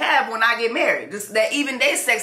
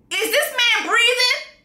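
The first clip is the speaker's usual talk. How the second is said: high, slow disgusted question; mock outrage; 12 semitones higher, slower than usual. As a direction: stretch it out in high disbelief. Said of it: "hates this man so much".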